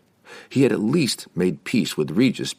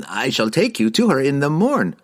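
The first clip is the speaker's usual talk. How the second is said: fussy wizard; 8 semitones higher, 3 dB louder than usual. Acted. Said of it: "Harkle began".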